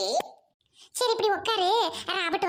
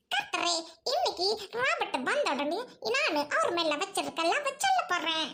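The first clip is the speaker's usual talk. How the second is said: prayer; 3 semitones higher than usual. Acted. Prays in church.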